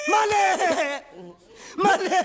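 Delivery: wailing call. Acted. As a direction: call out as if losing him forever. Servant cries out to master at parting. (बिलखते हुए पुकारना)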